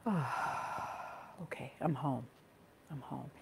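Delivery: very soft, relieved exhale into the words; sigh of arrival. Acted. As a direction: settle in with a sigh, almost under the breath. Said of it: "some part of you goes".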